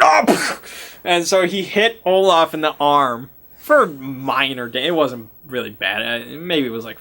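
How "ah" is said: sudden high startled yelp. Retold or imitated. Spooked player character yelping at the doorbell. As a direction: jump at the bell with a sharp cry. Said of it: "He's like".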